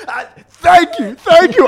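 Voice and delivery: high, loud gushing thanks; so happy. Retold or imitated. Speaker jumps up to thank Ali, overjoyed. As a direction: reenact overjoyed relief, voice leaping up. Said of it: "I'm so happy".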